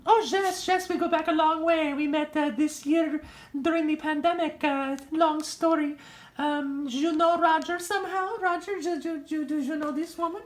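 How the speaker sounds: loud, proud Linda reeling off her names; loud soap-opera fiancée voice; brassy Linda voice. Acted. Linda continues talking.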